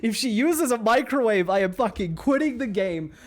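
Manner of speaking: mock rant. Real, not acted. Streamer ranting about microwave.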